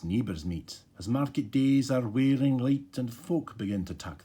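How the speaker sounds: slow, loud recital in a put-on Scottish accent; attempted Scottish accent. Reading Tam o' Shanter to viewers by the fire, in a slow Scottish accent. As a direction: give Burns a go in a learner's Scottish accent, slowly. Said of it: "it's all written in Scottish dialect".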